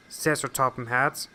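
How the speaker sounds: usual speaking voice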